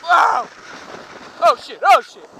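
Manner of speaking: loud high yell mid-jump; excited shout. Real, not acted. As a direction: yell as the ski lifts off. Ski jump, shouting while riding.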